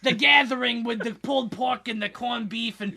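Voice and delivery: put-on loud, brash New York accent. New York accent, ranting about his nephew on the way to the gathering. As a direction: bark it out like a New Yorker stuck in traffic. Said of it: "classic New Yorker".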